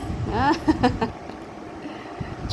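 soft breathy laugh, trailing away; real voiceless chuckle. Real, not acted. Owner amused while coaxing the pet along, laughing.

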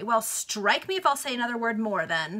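plain reading voice

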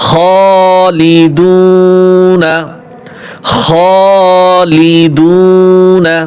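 খলিদুনা chanted very slowly, vowels held long; long drawn recitation. Shown as a demonstration. Teacher models খলিদুনা for students, slow and drawn out. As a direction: stretch out খলিদুনা for the class. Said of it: "এগুলো এক আলিফ টান হবে".

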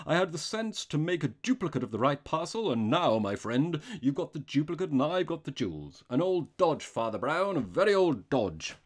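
loud gloating robber; delighted crying out. A narrator's reading. Robber boasts of duping the priest, crying out. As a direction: boast at full voice. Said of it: "he cried".

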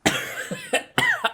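harsh choking cough; coughing fit. Real, not acted. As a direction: cough from hot pepper. Eating hot pepper.

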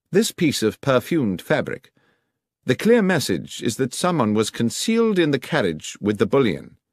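a hint of the assured investigator; detective explaining. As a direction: lay out the clue with assurance. Voices Holmes explaining a clue.

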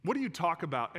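normal talking voice

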